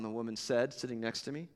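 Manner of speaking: ordinary delivery, nothing marked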